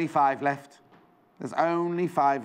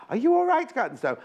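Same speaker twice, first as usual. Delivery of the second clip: high, fast, sing-song and condescending; mock-patronising fuss over the snow; 10 semitones higher, 5 dB louder, much faster than usual. Shown as a demonstration. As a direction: talk down to her like a child. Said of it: "That sounded patronising".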